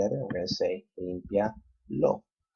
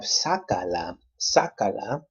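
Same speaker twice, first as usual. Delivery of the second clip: sácala with the first A punched; careful sácala, accent on third-to-last syllable; stress on SÁ; 4 dB louder, slower than usual. Shown as a demonstration. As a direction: hit SÁ hard in sácala, then let the rest follow. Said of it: "we have to keep the emphasis there".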